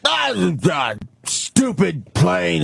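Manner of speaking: high, slurred belligerent shouting; drawn-out drunken bellowing; drunken yelling passenger. Retold or imitated. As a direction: holler incoherently like a loaded businessman. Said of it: "guy keeps going. He's like".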